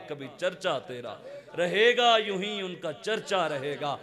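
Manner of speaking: measured, declaimed devotional couplets; verse recitation. Real, not acted. Preacher closing his sermon with praise verses, measured recitation. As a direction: recite with weight on each falling phrase.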